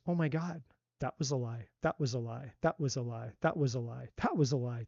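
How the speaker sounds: softly voiced dawning realization, low and repetitive; hushed realization. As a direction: say it low and soft, as if it just sinks in. Imagined woman realizing the lies.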